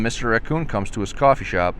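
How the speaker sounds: plain reading voice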